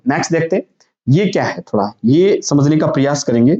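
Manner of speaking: usual speaking voice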